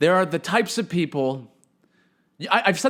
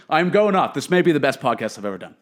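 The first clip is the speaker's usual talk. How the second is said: ordinary talk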